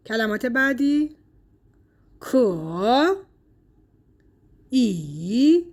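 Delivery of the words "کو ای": "کو" and "ای" apart, each dipping in third tone; two third tones. Shown as a demonstration. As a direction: say "کو", pause, "ای", both in full third tone. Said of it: "هر دو تون سه".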